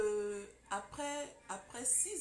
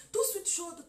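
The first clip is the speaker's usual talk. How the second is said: loud burst; 8 semitones higher, 9 dB louder than usual. Acted. Hot and cold contrast.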